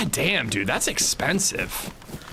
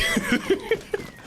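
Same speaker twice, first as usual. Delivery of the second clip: high squealing laugh. Real, not acted. Embarrassed about saying she.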